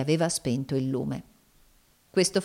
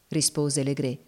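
ordinary delivery, nothing marked

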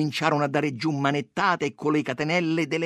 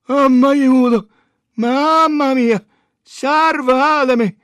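high, loud, drawn-out cries for help; dying man's wail; 13 semitones higher, 12 dB louder, much slower than usual. A narrator's reading. Beaten boy begging to be saved, loud high wailing. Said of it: "si lamentava come stesse per morire".

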